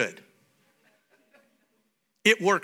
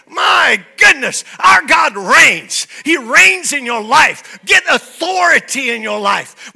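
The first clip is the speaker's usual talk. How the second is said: loud, slow, higher preaching shout; full-force preaching; 8 semitones higher, 9 dB louder, slower than usual. Acted. Pastor to congregation, loud slow proclamation. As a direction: proclaim it with all your lungs.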